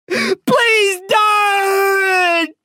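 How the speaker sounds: high, wailing howl of pain; tearful howl. A narrator's reading. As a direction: howl it through tears, pleading, drawn out. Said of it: "I howl through tears".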